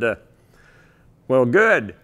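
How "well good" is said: Alan Savory's blunt reply. Retold or imitated. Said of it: "in pure Alan Savory style".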